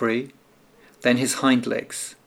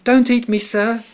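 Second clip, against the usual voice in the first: high, small mouse voice begging; frightened little mouse, pitched high; little mouse pleading; 10 semitones higher, 5 dB louder than usual. A narrator's reading. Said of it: "the mouse said quickly".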